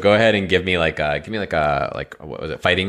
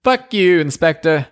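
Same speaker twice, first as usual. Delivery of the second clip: high, loud parting curse; mock cursing shout; 12 semitones higher, 4 dB louder than usual. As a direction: shout it while drifting away, hammed up. Machine cursing as it floats away.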